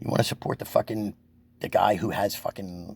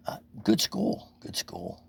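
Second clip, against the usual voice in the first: whispered aside; 5 semitones higher, 3 dB quieter, slower than usual. Real, not acted. Whispered aside about school.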